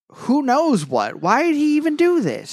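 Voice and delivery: mock-anguished rise, pitched high; exasperated retelling. Co-host voicing Eren questioning his father, exasperated.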